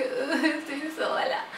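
slow, laughing fake sob; mock lament. Missing her dog, a slow half-laughing mock lament. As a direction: whine it in self-mocking despair. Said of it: "estoy así como que".

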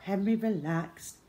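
usual speaking voice

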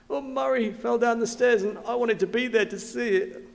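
mock childish complaint through tears; upset little boy. Voices crying three-year-old in story.

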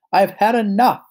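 high, loud exasperated outburst; angry voice. A narrator's reading. Mummy Pig to her naughty piglets, angry voice. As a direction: raise your voice like a fed-up mother. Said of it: "she said in an angry voice".

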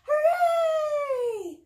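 high, loud cheer; shouted hooray. Acted. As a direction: shout it out for the kids. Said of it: "shout hooray".

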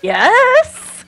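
excited high yes. Real, not acted. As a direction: blurt it out with delight. Excited reply about ocelots.

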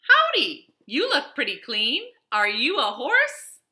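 high, loud friendly howdy; cheerful cowboy greeting. Acted. Cowboy greeting a wagon, high and loud. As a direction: call out like an eager young cowboy. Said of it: "Cowboy greets a bat".

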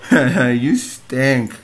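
drawn-out mocking tease; mocking commenter. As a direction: taunt like a heckler, dragging it out. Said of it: "That's probably someone's gonna say".

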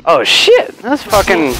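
high-pitched startled cry; shocked yelp. Real, not acted. Surprised by enemy reappearing.

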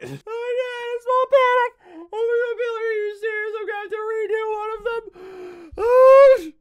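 panicked squeal. Real, not acted. Panicking at game mishap.

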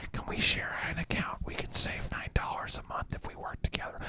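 conspiratorial whisper; sneaky whisper. Retold or imitated. Account-sharing hacker pitching a deal, sly whisper. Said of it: "He's like".

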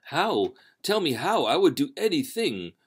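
high, loud and urgent; desperate eager offer; urgent plea. A narrator's reading. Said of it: "replied Aubrey".

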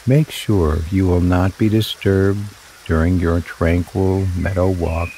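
normal talking voice

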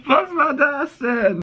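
high, slow, incredulous shout; baffled shout. Real, not acted. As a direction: drag out the disbelief. Baffled after odd phone call.

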